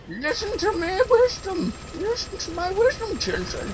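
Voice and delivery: high, put-on sage voice; high-voiced wise teacher. Gamer telling children of wisdom, high put-on voice. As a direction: preach it in a high silly voice.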